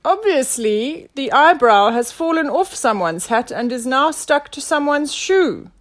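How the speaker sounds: loud, high declaration; pompous detective explaining his theory; self-assured inspector. A narrator's reading. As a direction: proclaim the deduction.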